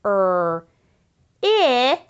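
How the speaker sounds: "Er, ip" split into its sounds, each one said apart; sounding out. Shown as a demonstration. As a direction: sound out "Er" then "ip" slowly. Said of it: "Sound it out with me".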